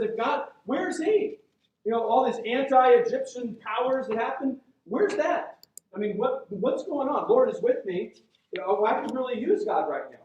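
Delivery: imitated protest, emphatic and aggrieved; frustrated. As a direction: demand answers as the frustrated man. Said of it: "He's, he's really frustrated".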